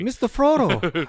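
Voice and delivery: a hammed-up Shire accent; hobbit voice. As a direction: chirp it like Sam to Frodo. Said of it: "sounding like Pippin or Merry".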